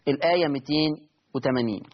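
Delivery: normal talking voice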